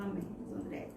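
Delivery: breathy hushed reply; a whispered amen to her friend. Retold or imitated. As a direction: barely voice it, almost a whisper.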